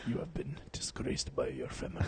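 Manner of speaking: whispered, solemn movie line. Retold or imitated. Quoting the movie to the partner he let go, in a whisper. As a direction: hiss it softly like the villain. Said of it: "I was like".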